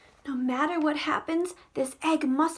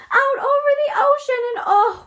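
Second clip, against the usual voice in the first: excited cry; 10 semitones higher, 8 dB louder, faster than usual. Acted. Dramatic voyage line.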